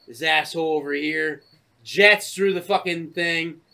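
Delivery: usual speaking voice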